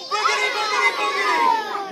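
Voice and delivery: high, loud magic chant; kids party magician. Acted. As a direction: cast the spell with gusto. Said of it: "On the count of three".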